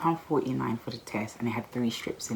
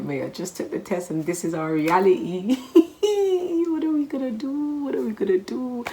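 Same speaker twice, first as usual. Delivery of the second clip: much higher and louder, laughing and crying at once; half laughing, half sobbing in mock despair; laugh-crying panic; 12 semitones higher, 7 dB louder than usual. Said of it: "I was like".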